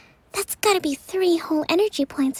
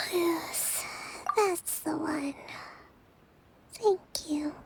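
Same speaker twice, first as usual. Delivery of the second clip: sleepy whisper; slower than usual. Acted. Drowsy Kokomi nodding off.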